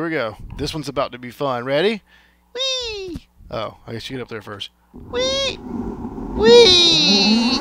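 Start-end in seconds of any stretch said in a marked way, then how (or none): 2.5-7.6 high gleeful whee; excited whee. Real, not acted. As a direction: squeal with joy on the ride. Riding the zip line.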